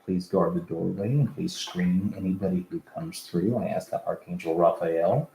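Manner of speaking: quiet, low prayer voice. Real, not acted. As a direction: softly ask the archangels for protection. Prayer of protection before session.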